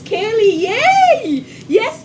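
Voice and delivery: high, loud little "yay"; gleeful, high-pitched hurrah; excited cheer. Real, not acted. Cheers a viewer by name.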